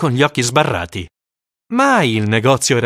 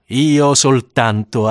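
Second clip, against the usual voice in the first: plain reading voice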